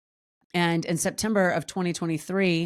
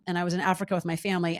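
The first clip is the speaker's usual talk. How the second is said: ordinary delivery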